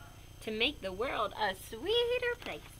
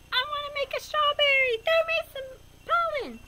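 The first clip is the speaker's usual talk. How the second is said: much higher, tiny flower character voice; talking flower; 9 dB louder than usual. Acted. Little flower asking for pollen, in a high, loud character voice. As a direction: play a little flower begging for pollen, pitched way up. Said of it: "He says".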